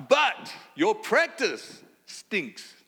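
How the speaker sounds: "But" and "practice" punched high and loud, very slow; Paul's blunt rebuke. Acted. Paul rebukes the church for its practice, bluntly and emphatically. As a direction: hit "But your practice stinks" loud, word by word. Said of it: "Paul is able to say".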